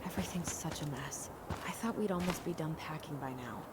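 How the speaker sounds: hushed, unvoiced whisper of a weary mother. Worn-out mother whispering about the packing mess.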